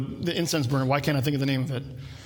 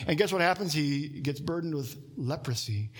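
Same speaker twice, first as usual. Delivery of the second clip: ordinary talk, nothing marked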